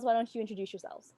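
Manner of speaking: ordinary talk, nothing marked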